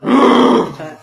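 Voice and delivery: high, loud whooped cheer; excited whoop. Real, not acted. Host whoops before starting the timed round, all hyped up. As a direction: pump up the room.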